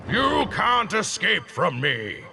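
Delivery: slow, booming threat. Acted. Immortal booming at the fleeing group, slow and big. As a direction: boom it out slowly. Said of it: "a voice boomed".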